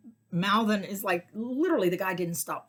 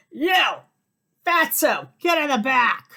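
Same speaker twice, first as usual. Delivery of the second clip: much higher, louder rude order; mock drunk bark; 8 semitones higher, 9 dB louder than usual. Drunk father yelling at his son to sit in back. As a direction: bark it at the kid like a boorish drunk.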